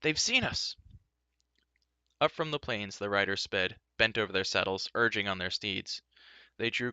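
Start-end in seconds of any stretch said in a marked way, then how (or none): 0.0-0.8 high, alarmed warning. Acted. Bard warning companions, high and alarmed. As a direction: cry out that they are spotted.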